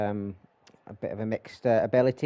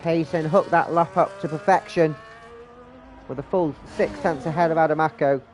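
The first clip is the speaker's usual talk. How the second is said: race commentary; 3 semitones higher, 4 dB louder than usual. Real, not acted. Live race play-by-play.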